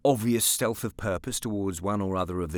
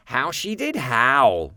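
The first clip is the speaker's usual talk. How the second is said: slow, drawn-out gleeful remark; mildly cheerful; 4 semitones higher, 6 dB louder, much slower than usual. A narrator's reading. Boy watching cousin leave, mildly cheerful. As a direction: savour the cousin's misfortune. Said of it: "said Nicholas cheerfully".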